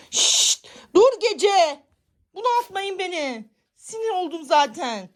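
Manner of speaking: hissed shush then high loud scolding; angry scolding. Real, not acted. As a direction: shut the cats down furiously. Scolding cats. (kedilere öfkeyle bağırma)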